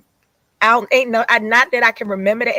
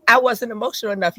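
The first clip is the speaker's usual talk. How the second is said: usual speaking voice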